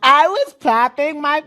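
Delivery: high, slow mocking girl voice; mock wife quote. Podcaster mocks the wife's old brag in a high voice. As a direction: mimic her bragging in a high drawl. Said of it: "that's your wife, bro".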